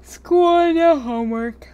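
long, drawn-out yawning words; talking through a yawn. Real, not acted. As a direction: say it mid-yawn, stretched out. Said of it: "Sorry, I'm yawning so much".

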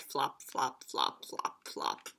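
low soft fading flops; hushed flop flop; whispered flops. A narrator's reading. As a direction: let them die away. Sound effect fading.

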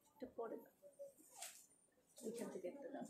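hushed instruction to a helper; whispered aside. Real, not acted. Seller whispering to her helper mid-live, whispered. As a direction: drop to a whisper so viewers barely hear.

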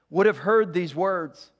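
ordinary talk

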